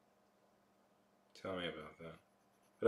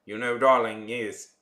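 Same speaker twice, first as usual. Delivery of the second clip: mock Mid-Atlantic accent; 3 semitones higher, 11 dB louder, slower than usual. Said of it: "It's like".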